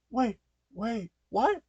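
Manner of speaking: high-pitched, baffled questioning; startled confusion. Acted. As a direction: blurt it out in high surprise. Elizabeth caught off guard by dog.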